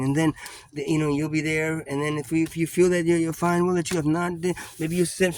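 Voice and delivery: mock-sweet cop selling the hospital as a vacation; persuasive cop.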